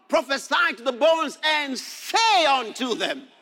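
loud, high, declaiming preacher; full-force preaching. Real, not acted. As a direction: proclaim it to the congregation, drawing out key words.

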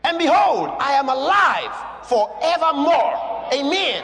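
loud, high shouted proclamation; preaching at full force. Acted. Preacher shouting Jesus' words to John, loud and high. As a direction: thunder it out to the church.

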